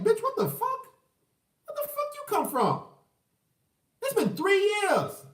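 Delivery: very high-pitched, incredulous squawk; mock outraged disbelief. Man answering ex after three years, mock outrage. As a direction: shoot way up in comic indignation. Acting out man's outraged reply.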